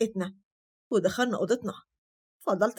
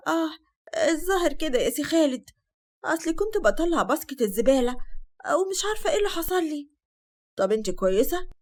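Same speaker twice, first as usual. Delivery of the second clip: fake pained whimper; 6 semitones higher than usual. Hind fakes a fall to Khaled.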